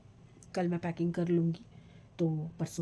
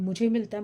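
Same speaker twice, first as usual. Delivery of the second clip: मुझे hit louder than the rest; stress on मुझे; 3 semitones higher, 6 dB louder than usual. Real, not acted. Vlogger telling viewers it works for her personally, stress on मुझे. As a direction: punch मुझे to mean only me.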